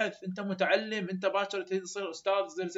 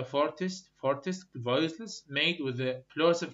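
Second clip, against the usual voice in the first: very slow model answer, word by word; slow dictated sample answer; slow dictation; much slower than usual. Shown as a demonstration. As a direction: spell the answer out for note-taking.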